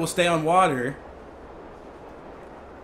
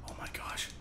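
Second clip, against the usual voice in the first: breathless hushed whisper; frozen whisper as the shark circles below; 20 dB quieter than usual. Real, not acted. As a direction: whisper in dread. Shark right beneath him.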